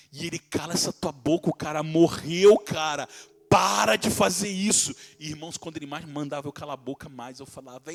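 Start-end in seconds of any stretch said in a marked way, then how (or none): 0.4-4.9 loud, slowed scolding, pitched up; angry friend. Retold or imitated. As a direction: order him to shut up, furious. Friend angrily telling him to stop.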